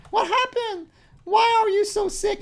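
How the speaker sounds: put-on very high falsetto girl; mock girly voice. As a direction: squeak like a silly girl. Reading chat as the girl.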